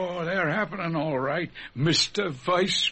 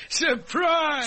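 laughing; 7 semitones higher than usual. Acted. Boss springs the surprise.

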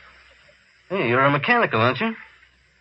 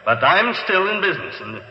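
raised pitch, firm refusal; defiant; 7 semitones higher than usual. Acted. Rival dealer refusing a humanoid, defiant. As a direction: push back against the sales pitch.

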